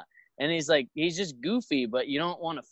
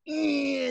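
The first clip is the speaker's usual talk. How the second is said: high strained grunt; high flexing grunt; 14 semitones higher than usual. Retold or imitated. Imitating Tyson flexing.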